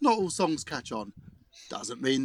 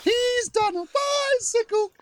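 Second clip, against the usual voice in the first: much higher, slow rising excitement; excited commentary; 3 dB louder than usual. Acted. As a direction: call it like a thrilled commentator.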